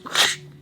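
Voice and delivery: short voiceless snatching sound effect; imitated grab noise. Storyteller showing Missus Peach grabbing documents, quick voiceless noise. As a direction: make the noise of papers being snatched. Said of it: "grabs it and goes".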